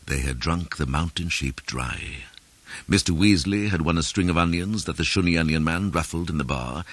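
ordinary delivery

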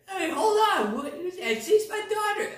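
high-pitched put-on voice of an alarmed mother; alarmed Elizabeth. Mother protesting over her daughter, hammed-up high voice. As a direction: cry out high, as a mock frantic parent. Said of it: "But then Elizabeth, she's like".

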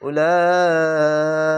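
উলাইকা, very slow, one sustained note on "laa"; four-alif madd. Shown as a demonstration. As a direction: stretch the "laa" of উলাইকা very long before "ika". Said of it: "মোটা চিহ্ন চার আলিফ টেনে".

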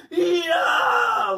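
long, high, loud yelp of pain. A narrator's reading. Paul grabs Leslie's pigtail, she yelps in pain. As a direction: shriek it out as hair is yanked. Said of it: "Leslie yelped".

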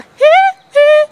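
a jokey high-pitched hee-hee. Retold or imitated. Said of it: "[name] bad volley".

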